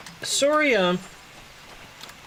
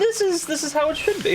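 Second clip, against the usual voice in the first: gloating; 10 semitones higher, faster than usual. Retold or imitated. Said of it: "I'm just like".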